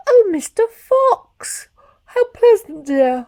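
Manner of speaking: high, loud, slow drawn-out wife's voice; Missus Fox. Acted. Missus Fox answering her husband, high and slow. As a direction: stretch it out high like a lady fox.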